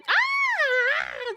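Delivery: high, delighted "ah"; excited squeal. Real, not acted. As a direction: squeal in delight at a new arrival. Greeting a new viewer, excited squeal.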